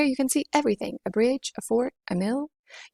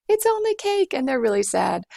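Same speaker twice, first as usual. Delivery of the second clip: much higher and louder, coaxing; pleading inner voice; 5 semitones higher, 4 dB louder than usual. Acted. Inner voice pleading for the sad dwarves, high and loud. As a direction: coax Rosie to give up the cake.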